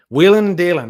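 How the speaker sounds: called out loud and bright; savoring a Southern phrase out loud; exclaimed phrase. Real, not acted. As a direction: announce the phrase with a punch. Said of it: "is that a Southern phrase".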